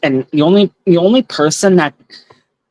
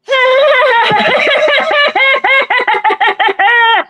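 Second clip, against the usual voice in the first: wicked witch cackle. Shown as a demonstration. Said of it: "This is how I laughed".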